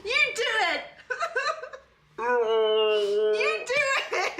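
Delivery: high, helpless laughing protest. Real, not acted. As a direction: squeal it through real laughter. Laughing after her failed accent attempt.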